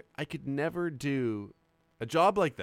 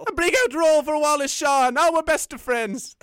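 hammed-up Irish brawler, pitched way up; Irish leprechaun; 11 semitones higher, 7 dB louder than usual. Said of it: "he turned into a leprechaun".

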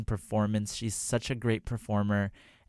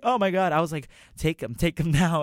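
louder, higher, giddy with laughter; excited fan; 8 semitones higher, 7 dB louder than usual. Retold or imitated. Fan offering her homemade pants to the singer, giddy. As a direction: blurt it out, starstruck and laughing.

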